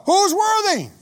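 high, loud heavenly cry; angel crying out. Acted. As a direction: fling the question across heaven. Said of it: "the angel cries out".

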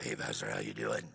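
very low, hushed, hoarse croak; lost drill-instructor voice. Acted. Drill instructor greeting pastor at church, voice lost. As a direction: imitate a drill instructor whose voice is shot. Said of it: "they talk a little bit like that".

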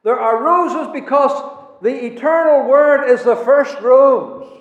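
high, loud pulpit proclamation; full-force preaching. Acted. Preacher proclaiming to the congregation, loud and high. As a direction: preach it out to the whole hall.

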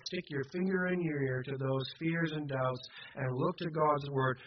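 ordinary delivery

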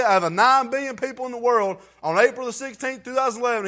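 high, loud, fervent preaching; preaching at full force. Acted. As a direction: shout the testimony to the church.

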